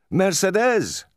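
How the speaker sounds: high, ringing joyful call; delighted high-pitched hail from outside; joyous cry. A narrator's reading. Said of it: "cried a voice joyously".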